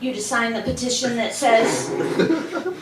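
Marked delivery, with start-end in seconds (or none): 1.9-2.8 very high, squeaky little frog; high-pitched puppet frog; Baby Ribbit. Pretend. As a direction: croak ribbit in a tiny high voice. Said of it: "Baby Ribbit".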